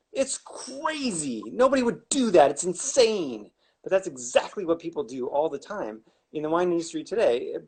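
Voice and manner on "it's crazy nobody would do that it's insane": high, drawn-out incredulous outburst; exasperated disbelief. Real, not acted. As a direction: stretch the key words in high-pitched disbelief. Mocks the cinnamon barrel idea.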